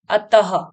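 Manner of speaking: "अतः" ending in breathed ha; visarga h sound. Shown as a demonstration. Teacher showing pupils the h sound, audible ha closing "अतः". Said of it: "ह का स्वर निकल रहा है".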